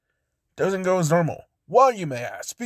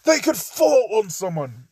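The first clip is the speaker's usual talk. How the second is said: put-on British accent; 7 semitones higher than usual. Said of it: "Ito's just saying".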